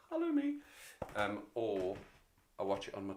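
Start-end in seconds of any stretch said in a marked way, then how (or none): 0.1-0.5 hello me jumps way up, falsetto; squeaky hello to himself. Real, not acted. Streamer spotting himself on screen, squeaky hello. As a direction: greet your own reflection in a tiny high voice.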